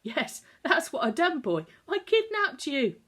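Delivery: a gleeful laughing confession. Acted. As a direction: laugh at his own daring. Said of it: "he burst into laughter".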